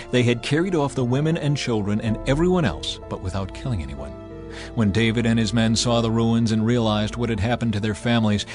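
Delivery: usual speaking voice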